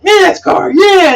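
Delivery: high whooping impression of a cartoon kid; mock excited cheer. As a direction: imitate an excited shout, high-pitched. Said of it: "and he's like".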